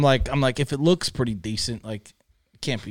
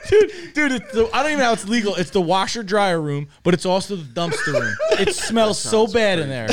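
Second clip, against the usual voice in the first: loud, high and laughing in disgust; appalled and cracking up; laughing disbelief; 7 semitones higher, 6 dB louder than usual. Real, not acted. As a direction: protest through laughter, voice pushed up. Animated disgust at the room, laughing.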